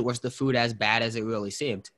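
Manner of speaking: ordinary delivery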